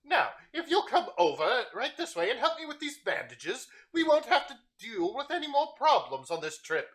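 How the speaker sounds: high, strained voice of a sick, bitter man; sick, irritable Gilles. Acted. Sick patient complaining to the caravan merchant, high and irritable. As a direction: snap at the merchant through the pain.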